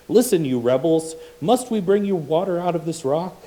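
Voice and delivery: angry rebuke. Acted. Moses shouting at rebels in reading.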